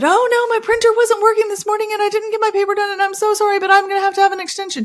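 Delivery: high, fast pleading whine; whiny student excuse. Retold or imitated. Student begging the teacher for an extension, high fast whine. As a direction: rattle off the excuse in a high panic. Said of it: "you said".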